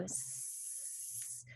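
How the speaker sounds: long soft hiss on "S-"; voiceless S. Shown as a demonstration. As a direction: hold "S-" with the voice off. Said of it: "this one has the voice off".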